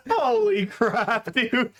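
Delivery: slow words through laughter; laughing disbelief; slow laughing exclamation. Real, not acted. Laughing at tier list joke.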